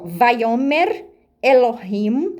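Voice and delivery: Vayomer Elohim spelled out slowly, each syllable clear; Hebrew read slowly. Shown as a demonstration. Teacher reading the Hebrew verse to viewers, slow and careful. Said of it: "Eu vou ler pra vocês em hebraico essa frase".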